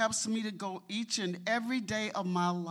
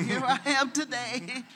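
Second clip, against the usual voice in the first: laughing; 7 semitones higher, 8 dB louder than usual. Real, not acted. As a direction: repeat it through a laugh, louder.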